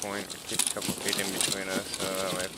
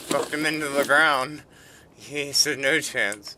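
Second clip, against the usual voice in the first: excited gloating; 5 semitones higher, 6 dB louder, slower than usual. Real, not acted. Gloating after a kill.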